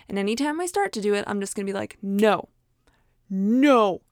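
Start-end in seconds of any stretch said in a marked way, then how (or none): 2.0-4.0 firm no. Acted. Telling herself to stop.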